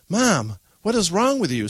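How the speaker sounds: high, loud reproach; exasperated scolding. Acted. Daughter confronting her mother after the outburst, exasperated and loud.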